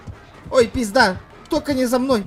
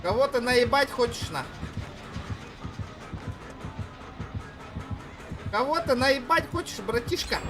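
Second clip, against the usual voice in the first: russian street thug; 5 semitones higher than usual. Pretend. Mock tough talk to enemy.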